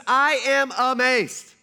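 bad amazed, drawn out in outrage; all caps anger. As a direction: spell out each word like shouting in all caps. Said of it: "this would be all caps".

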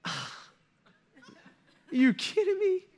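crushed disbelief, long hesitant start, high pitch; Saul. Retold or imitated. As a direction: let disappointment squeeze the voice up high. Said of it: "Can you imagine the disappointment".

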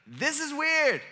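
high, loud mock bewilderment; hammed-up disbelief; baffled outburst. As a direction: blurt it out high, imitating baffled followers. Acting out the baffled disciples.

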